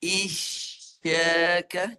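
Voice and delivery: "Isyaka" drawn out very slowly with a clear sh; "Isyaka" slow, with a rising end; with shin. Shown as a demonstration. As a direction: stretch "Isyaka", raising mid-tongue on the sh. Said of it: "guna tengah lidah".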